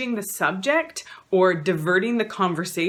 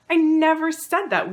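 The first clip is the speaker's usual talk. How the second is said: high and louder, hammering the denial; louder insistent denial; 10 semitones higher, 5 dB louder than usual. Retold or imitated. Gaslighter to partner, louder mock denial. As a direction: push the denial harder, raise the volume. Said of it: "Or they might even say".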